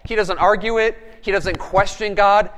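normal talking voice

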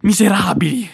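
high, loud furious scream of "Miserabili!". A narrator's reading. Killer screams at the officers in fury. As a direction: hurl it at them as a scream.

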